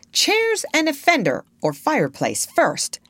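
put-on high-pitched bossy boy; giving orders. A narrator's reading. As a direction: command it like the leader. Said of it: "Peter ordered".

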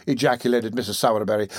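plain reading voice

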